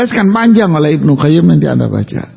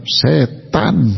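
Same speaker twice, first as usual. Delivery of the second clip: emphatic East Javanese, both syllables drawn out; weighty, deliberate East Java pronunciation; firm East Javanese accent; 4 dB quieter than usual. Shown as a demonstration. Preacher showing how East Javanese say setan, firm and emphatic. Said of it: "orang Jawa Timur ngomongnya mantep".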